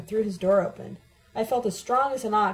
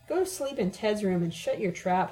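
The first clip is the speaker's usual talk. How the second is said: usual speaking voice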